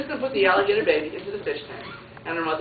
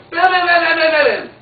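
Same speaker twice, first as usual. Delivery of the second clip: high, loud rapid-fire bams; hammering door-knock sound effect; loud knocking imitation; 9 semitones higher, 10 dB louder than usual. A narrator's reading. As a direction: pound on the door with your voice.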